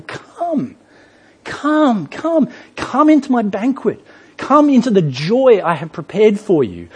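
high, tender calling to come; inviting God. Acted. God calling people into his banquet, high and tender. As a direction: coax them in gently, voice lifted. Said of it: "repeatedly says to people".

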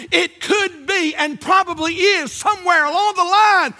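high, driving pulpit delivery with punched phrases; urgent, rhythmic sermon climax; impassioned preaching. Real, not acted. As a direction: preach it hard, building in pitch. Preacher at full force.

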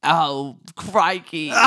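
attempted British that comes out Australian; badly faked British, sliding into Aussie; hammed-up, muddled British accent; failed British accent. Shown as a demonstration. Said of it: "Do British".

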